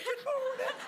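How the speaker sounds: very high, squeaky take on Scottish; mock Scottish accent. Host echoes guest's Scottish greeting, in a very high mock accent. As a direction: put on a hammed-up Scottish lilt way up high. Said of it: "do your Scottish accent".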